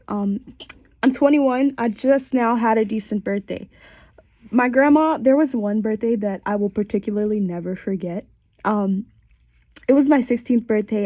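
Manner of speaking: usual speaking voice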